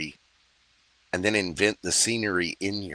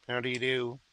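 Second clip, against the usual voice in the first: mock polite greeting; 5 semitones higher, 5 dB quieter than usual. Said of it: "Silence of the Lambs".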